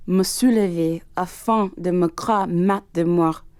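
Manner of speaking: tormented diary read in French; dramatic French reading. Acted. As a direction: read it as a haunted man's confession.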